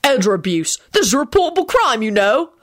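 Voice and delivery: officious indignation; barked. A narrator's reading. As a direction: bark out the accusation. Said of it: "barked the senior medic".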